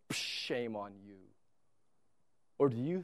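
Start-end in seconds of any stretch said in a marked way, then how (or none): 0.1-1.2 scornful. Pretend. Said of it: "he says".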